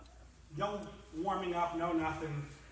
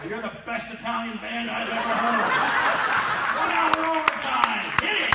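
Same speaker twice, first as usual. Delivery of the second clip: much higher and louder, building to a shout; booming father; 6 semitones higher, 14 dB louder than usual. Retold or imitated. Bride's father ordering the band to play overtime, shouting. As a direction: roar the order like the boss of the party.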